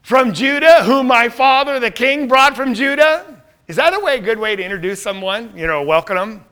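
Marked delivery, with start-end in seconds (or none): 0.0-3.4 louder, higher, arrogant questioning; sneering royal put-down; sarcastic. Acted. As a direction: look down on him with mock curiosity. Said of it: "in that kind of sarcastic way".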